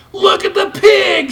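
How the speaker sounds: very high, loud jeering shout; high loud taunting lamb. A narrator's reading. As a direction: yell it like a mocking young lamb. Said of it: "shouted one".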